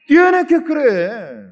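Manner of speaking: high, drawn-out preacher's cry; emphatic exhortation. Real, not acted. Preacher tells congregation to believe, exhorting.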